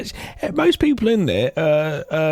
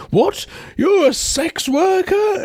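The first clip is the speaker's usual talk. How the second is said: much higher, slow, aghast; shocked squeal; much slower than usual. Retold or imitated. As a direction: gasp it out, high and scandalised. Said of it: "so shocked by this".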